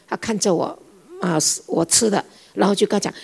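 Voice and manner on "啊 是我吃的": ordinary talk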